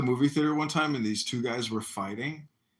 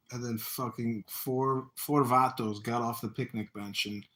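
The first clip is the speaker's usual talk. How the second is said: ordinary delivery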